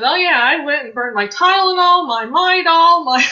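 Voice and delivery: bubbling over with the news; excited report. Retold or imitated. Friend calling back thrilled she burned everything, loud and high.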